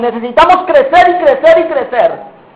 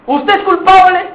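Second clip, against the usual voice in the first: interrogating judge; 6 semitones higher than usual. Acted. Trial questioning the accused.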